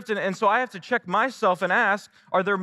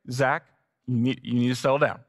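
lower, quick, soothing wife; a hint of a wife settling him down; wife telling husband to settle down, mildly calming; lightly voiced wife calming; 7 semitones lower than usual.

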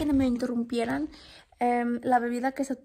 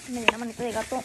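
ordinary talk, nothing marked

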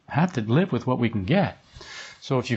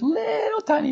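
stretched out high and tiny; little tiny voice. Retold or imitated. Describing a student's inner voice to the group, little tiny voice. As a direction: draw it out in a small, high voice. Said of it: "heard this little voice".

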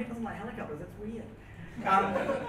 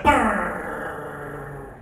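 rolling lips in a slow truck rumble; truck noise; 4 semitones lower, 5 dB louder than usual. Retold or imitated. Mates in the hot pool making truck noises, rumbling brrr. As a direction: buzz out a slow engine brrr. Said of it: "making truck noises".

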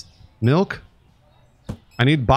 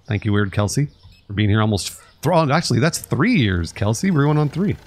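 ordinary talk

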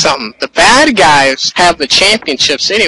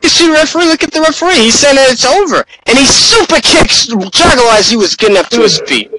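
much higher, urgent call that it's over; excited play-by-play; 7 semitones higher than usual. Acted. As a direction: announce the end with rising alarm. Live wrestling play-by-play.

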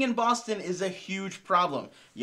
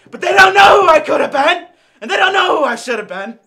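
much higher, much louder laughing wail; mock anguished shout; 6 semitones higher, 13 dB louder than usual. Comic regret over lost manhood, much louder hammed-up laughing wail.

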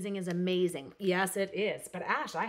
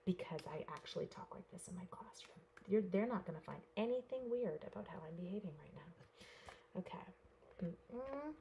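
soft classroom whisper; real hushed teacher whisper; 8 dB quieter than usual. Real, not acted. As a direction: whisper like keeping students calm. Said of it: "Why is she talking like this".

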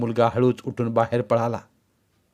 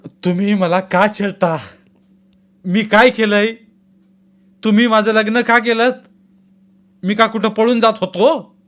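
higher and louder desperate protest; raised voice; 9 semitones higher, 5 dB louder than usual. A narrator's reading. Son shouting back at his father, loud and high. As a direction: push back at them, voice raised. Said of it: "एकदम आवाज चढवून बोलू लागला".

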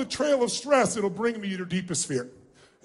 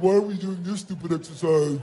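grumpy adult; 5 semitones lower than usual. Retold or imitated. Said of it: "If you do it like an adult".

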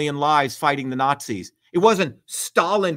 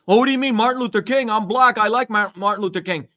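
mock indignant protest; 5 semitones higher, 3 dB louder than usual. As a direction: imitate an offended objector, higher pitched. Voices an objector to his argument.

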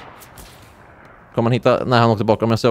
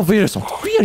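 usual speaking voice

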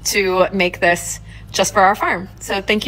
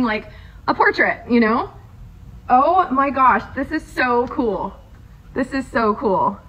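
usual speaking voice

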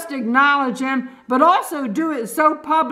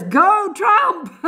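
cheering; 6 semitones higher than usual. Real, not acted. Cheering for candidate.

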